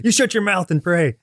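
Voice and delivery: hint of a mock-strict dad giving orders; stern dad. Dad to kid who won't pray, mock stern.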